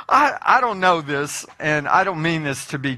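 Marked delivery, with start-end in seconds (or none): none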